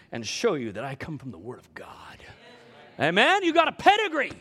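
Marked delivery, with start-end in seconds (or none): 0.1-2.2 whisper. Real, not acted. Aside to congregation, whispered.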